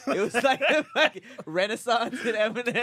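laughing while he talks; words breaking into laughter. Real, not acted. Laughing through a joke.

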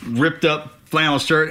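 usual speaking voice

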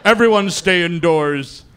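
slow, put-on announcer warning; hammed-up emergency broadcast; mock news bulletin.

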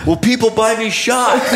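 collapsing into laughter at the excuse; high giggly quote; laughing high quote. Retold or imitated.

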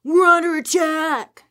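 high, slow, hammed-up panic; mock alarmed yell. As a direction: shout it like a frantic tabloid. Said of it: "these two papers were like".

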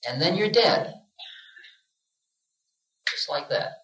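usual speaking voice

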